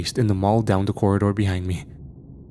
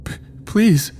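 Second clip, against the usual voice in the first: much higher, broken pleading voice; scared plea; 12 semitones higher, 3 dB louder than usual. A narrator's reading. Frightened kid begging the narrator to let go. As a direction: beg in a frightened squeak.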